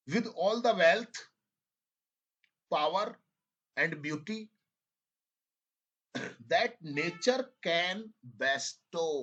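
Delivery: each phrase read out very slowly, with long pauses; slow dictation reading. Shown as a demonstration. Teacher dictating an English passage to students, slow and deliberate. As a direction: dictate it word by word for students to write. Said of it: "I am reading it again, second time".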